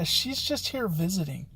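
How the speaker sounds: plain reading voice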